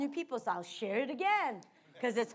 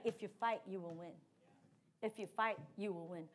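inner voice; 6 semitones lower, 10 dB quieter than usual. Retold or imitated. Hearing God's word in prayer.

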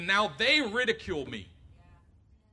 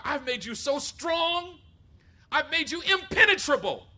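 higher, louder, slow proclamation; booming God voice; 8 semitones higher, 8 dB louder, slower than usual. Retold or imitated. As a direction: proclaim it like God making him strong. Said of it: "God is saying to Jeremiah".

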